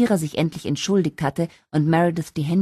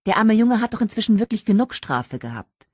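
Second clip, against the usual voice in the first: put-on high, louder protest on the boy's behalf; 8 semitones higher, 5 dB louder than usual. A narrator's reading. Kira to Richard about the punishment, protesting. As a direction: plead his case loudly.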